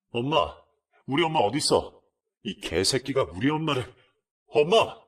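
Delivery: panicked yelling for his mother; high, loud frantic cry of a son; frantic shout. Retold or imitated. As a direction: burst in screaming for mom.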